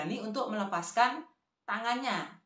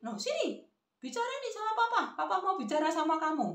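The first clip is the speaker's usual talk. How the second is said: high-pitched granny command; acted grandma, pitched up and insistent; bossy grandmother; 9 semitones higher than usual. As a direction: order the child over in a high voice. Grandma hands child the phone.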